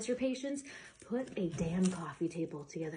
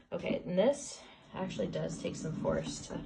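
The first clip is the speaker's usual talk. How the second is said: ordinary talk, nothing marked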